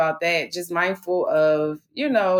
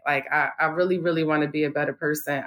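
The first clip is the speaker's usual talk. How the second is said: ordinary talk, nothing marked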